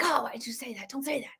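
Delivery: soft, dismayed aside under the breath; mock-groan it quietly to yourself; quiet wince. Wincing at something Trump said, said softly to herself. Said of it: "and I'm like".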